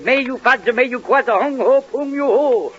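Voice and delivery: quoted mock Chinese. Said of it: "He said".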